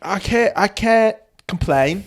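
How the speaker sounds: high mock British voice; badly faked British, drawn out at the end; bad British accent. Shown as a demonstration. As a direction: grope through a high British impression. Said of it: "good British accent".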